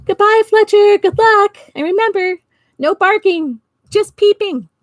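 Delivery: high, loud friendly call; shouting goodbye. Acted. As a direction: call out a cheerful farewell. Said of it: "They shouted goodbye".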